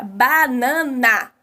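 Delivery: "banana" in three separate beats; syllable by syllable. Shown as a demonstration. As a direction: sound out "banana" as ba-na-na, evenly. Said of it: "eu tenho três emissões".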